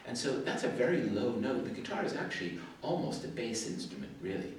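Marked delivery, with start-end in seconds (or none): none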